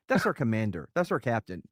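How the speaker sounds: normal talking voice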